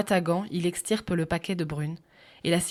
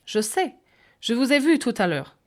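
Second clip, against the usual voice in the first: higher-voiced old English lady; old lady speaking French with an English accent; 5 semitones higher, 5 dB louder than usual. A narrator's reading. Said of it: "dans un accent anglais".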